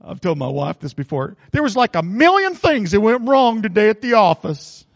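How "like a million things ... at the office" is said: ordinary talk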